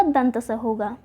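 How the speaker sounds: ordinary delivery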